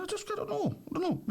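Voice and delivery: very high mock voice through laughter; laughing traveller voice. Friend imitating the traveller's excuse, high and laughing. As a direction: squeak it out, cracking up.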